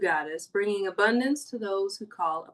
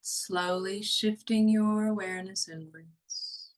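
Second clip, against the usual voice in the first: hushed guiding voice for a body-awareness meditation; guided meditation; 2 semitones lower than usual. Acted. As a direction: ease them down into calm, slowly.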